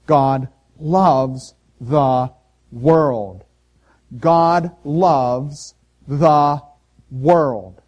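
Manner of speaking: each word slow and loud, God loves the world; slow emphatic declaration. Acted. Preacher hammering the key phrase.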